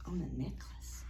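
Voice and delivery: conspiratorial storytime whisper. Acted. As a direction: let them in on a secret, whispered. Aside whispered to children.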